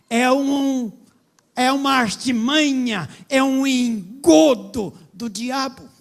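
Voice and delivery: loud, slow, fiery sermon delivery; preaching at full force. Acted. Pastor thundering at the church about gospel music. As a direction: hammer each question at the congregation.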